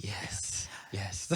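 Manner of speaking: breathy whispered yes of relief; relieved whisper. Retold or imitated. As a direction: sigh it out with relief. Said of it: "I breathed an audible sigh of relief".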